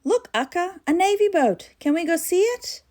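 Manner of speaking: put-on high eager child calling out; excited shout. Little boy calls to his auntie about the navy boat, excited and high. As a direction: point out the navy boat, thrilled. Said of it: "Bala shouted".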